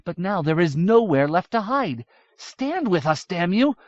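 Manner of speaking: rally him, ending in a curse; rousing young woman. A narrator's reading. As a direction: princess urging the Dwaro to fight. Miriamel's rallying speech voiced.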